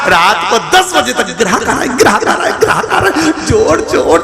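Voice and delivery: scolding guru, impassioned. Acted. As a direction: berate the rich man in a relentless rush. Guru's tirade at the seth.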